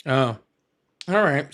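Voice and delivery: ordinary delivery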